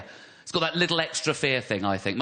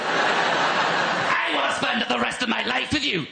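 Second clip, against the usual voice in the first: high, slow Northern Irish declaration; mock-threatening vow in a Northern Irish accent; scary Northern Irish accent; 8 semitones higher, 3 dB louder, slower than usual. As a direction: make love sound frightening, Northern Irish. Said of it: "being loving with that accent".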